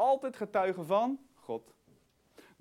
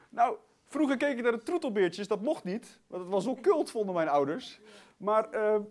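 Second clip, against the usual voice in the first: ordinary delivery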